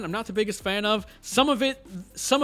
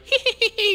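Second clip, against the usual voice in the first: high mock evil cackle; a quick hammed-up villain cackle; scary villain laugh; 4 dB louder than usual. Shown as a demonstration. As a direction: show the crazy laugh he should have had. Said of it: "with his scary laugh".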